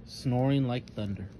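plain reading voice

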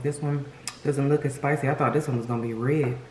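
ordinary talk, nothing marked

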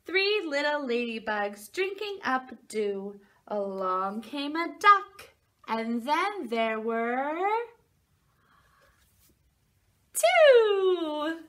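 slow sing-song, big loud reveal at the end; reading to a toddler. Acted. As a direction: build up then announce the number. Reads picture book to children.

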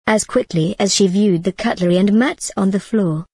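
ordinary delivery

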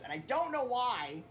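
usual speaking voice

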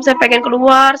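ordinary talk, nothing marked

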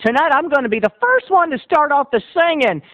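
high, drawn-out country showman; karaoke emcee. Acted. Country boy takes the microphone, hammed-up emcee. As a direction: welcome the crowd grandly, stretching the big words. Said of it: "Will picked up the microphone. He went".

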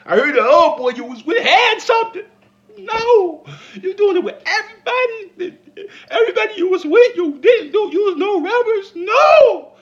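high, loud laughing shriek; hammed-up squealing outrage; shrieking disgust. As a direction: scream your disgust through laughter.

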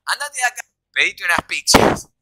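imitating the mother in the false version; mock order that turns to pizzas; the made-up version. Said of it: "la mentira es que dijo".

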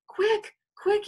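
urgent, high-pitched cry; high, urgent shouted warning. A narrator's reading. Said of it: "he shouted".

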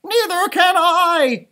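high, slow, loud howl. A narrator's reading. Flitter howls, unable to see in the dark. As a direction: wail it out in fear. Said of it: "howled Flitter".

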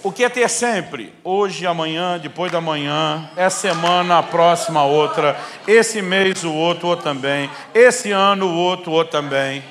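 loud, high, driving preaching voice; full-force preaching. Acted. Pastor stirring the congregation about continuity, at full force. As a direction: hammer each time word home to the crowd.

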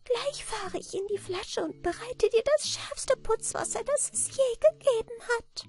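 very high, thin creature voice; eager little manikin. A narrator's reading. Little man promises the sharpest cleaning water, eager high voice. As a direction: urge her on in an eager tiny falsetto. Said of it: "sagte das Männlein eifrig".

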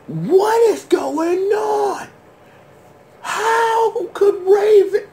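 high, slow, half-laughing wail; shocked cry. Real, not acted. Viewer stunned by Raven's secret, high slow wail. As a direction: cry out in disbelief. Reacting to the twist.

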